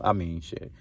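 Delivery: ordinary talk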